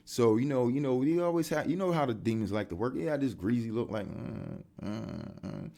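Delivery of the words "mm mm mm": mocking hums. Mimicking a hater's grunts.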